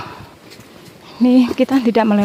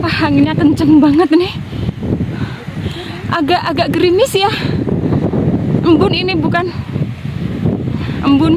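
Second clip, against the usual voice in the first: shouting over wind; 6 semitones higher than usual. Real, not acted. Strong wind on summit.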